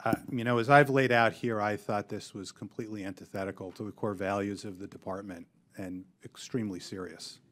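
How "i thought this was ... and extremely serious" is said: soft, dropping away toward the end; too quiet. Real, not acted. Witness answering on the level of bias, too quietly to hear. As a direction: let the volume sink until hard to hear. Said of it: "Can you speak up, please?".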